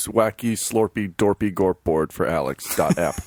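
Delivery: low, goofy nonsense words; goofy mock name. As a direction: rattle off the silly name low. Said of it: "I said wacky".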